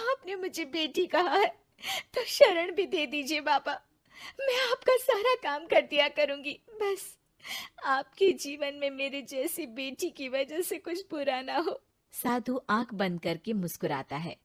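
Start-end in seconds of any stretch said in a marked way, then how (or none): 0.1-11.8 higher, teary begging; tearful plea. Acted. Ritu begging the sage for shelter, tearful and high. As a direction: plead through tears for a home.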